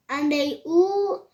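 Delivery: ordinary delivery, nothing marked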